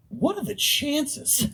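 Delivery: high, drawn-out dumb blurt; mock astonished outburst, high and slow; blurted out loud. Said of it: "said out loud in front of my class".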